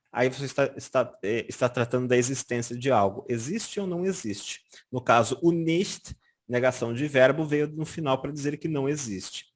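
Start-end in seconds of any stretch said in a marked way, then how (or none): none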